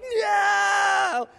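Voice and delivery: put-on high loud frightened shout of a toddler; child's scream. Pastor imitates his scared son shouting at Captain Hook. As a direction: scream no like a terrified small child. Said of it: "He's like".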